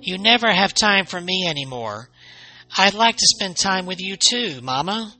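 plaintive cry. Acted. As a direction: plead like a child starved of attention. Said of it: "Chaki cried".

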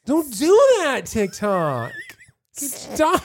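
high whiny nagging voice, a bit quick. Retold or imitated. Talking back to TikTok's nag in a high whine. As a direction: whine at the app like a nagging scold. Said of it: "Could you sound any more whiny".

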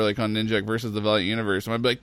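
usual speaking voice

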